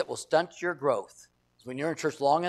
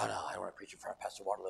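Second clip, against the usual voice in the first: fast, hushed student panic; nervous whisper; 7 semitones lower, 11 dB quieter, faster than usual. Retold or imitated. Nervous students dreading the pastor, quick anxious whisper.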